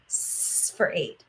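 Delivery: long soft hiss on the s; drawn-out s like a snake. Shown as a demonstration. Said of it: "hissing sound like a snake".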